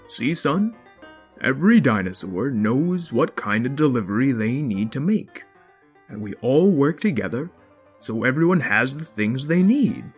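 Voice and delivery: lightly voiced patient father; gentle lecturing dad.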